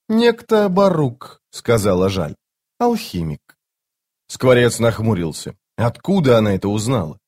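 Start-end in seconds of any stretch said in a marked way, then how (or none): 0.1-1.4 slow, high female scout; drawn-out, high female reply; young woman's voice. Acted. Scout answers the sergeant. 2.8-3.6 high female scout; put-on high girl's voice; young woman's voice. Scout adds a detail.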